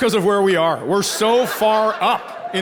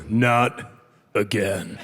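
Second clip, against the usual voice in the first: low, slow menace, dropping softer; nose-to-nose warning; 12 semitones lower, 4 dB quieter, much slower than usual. Acted. Angry dealer nose to nose with troublemaker, low warning. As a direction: warn him slowly, right up in his face. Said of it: "He gets nose to nose and he just goes".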